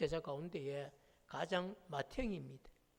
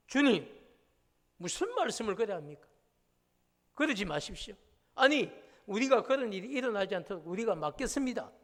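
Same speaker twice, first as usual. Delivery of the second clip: louder, higher, rebuking objection; indignant protest; 3 semitones higher, 6 dB louder than usual. Retold or imitated. Preacher acting Peter rebuking Jesus, loud indignant. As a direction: Peter pushing back at his master. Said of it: "그럼 이게 항변이에요".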